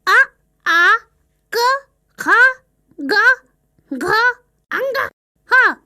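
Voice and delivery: अ, आ, क, ख, ग, घ, अंग, ह spoken one at a time with a pause between each; reciting letters back. Shown as a demonstration. Child repeating the letters to the parrot, careful and distinct. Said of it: "अब तुम बोलकर बताओ".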